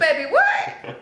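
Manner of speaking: high laughing what?; retold disbelief. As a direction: squeak the question in shock through laughter. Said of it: "And I'm like".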